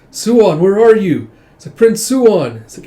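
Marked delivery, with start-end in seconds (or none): 0.1-1.3 put-on loud, high call for a missing child; raised, carrying search call. 1.8-2.6 loud, high searching call; raised voice carrying out in search. Acted. Searching for missing child.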